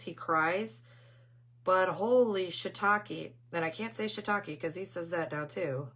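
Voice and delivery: ordinary talk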